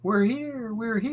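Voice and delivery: Whos from Horton. Retold or imitated. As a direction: squeak out like the Whos of Whoville. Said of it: "Horton Hears a Who?".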